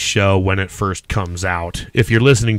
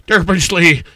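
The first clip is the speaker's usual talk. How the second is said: mock screaming; 9 semitones higher than usual. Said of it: "wake up in the middle of the night screaming".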